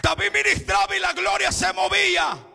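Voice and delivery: high, loud sung-out preaching cry; full-force preaching. Acted. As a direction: preach it to the back row, chanting each phrase.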